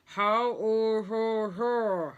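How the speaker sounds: muffled words through a stuffed cheek; gauze-stuffed patient mumbling; mouth full of gauze. A narrator's reading. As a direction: talk with gauze holding the mouth open. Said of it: "replied the man as best as he could".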